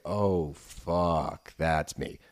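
lightly acted, low sinking realisation; dawning realisation. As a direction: let it slowly sink in, mildly hammed-up. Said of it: "slowly coming to terms like".

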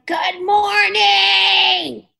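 long, high, drawn-out mock holler; imitated yell. Host imitating guitarist yelling at his neighbors, long high holler. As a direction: yell it owl-like, stretching each word out. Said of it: "but him yelling".

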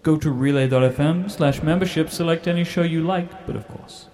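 upbeat promo announcer; ad read. Acted. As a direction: sell it like a trailer voiceover. Trailer ad read.

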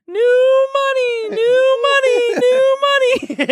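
very high, slow, stretched-out siren call; imitated street alarm. Joking demo of the neighborhood lookout's warning, imitated street alarm. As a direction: wail it like a human alarm. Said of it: "here's what their alarm sounds like".

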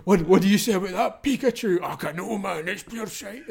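exaggerated Scottish bit. Creator parodying his own Pokemon video, hammed-up Scottish. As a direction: play up the Scottish accent for laughs.

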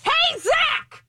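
yelling across the room. Retold or imitated. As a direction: shout it across the room to Zach, laughing. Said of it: "I'd be like".

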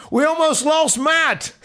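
high, drawn-out mock outrage; imitated punchline. Correcting the boss after the fight, high drawn-out imitation. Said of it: "just the way he said it and the delivery".

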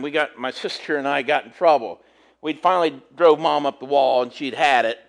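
normal talking voice

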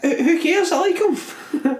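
high, drawn-out, laughing insistence; high-pitched protest. Real, not acted. Playful protest at co-host's criticism.

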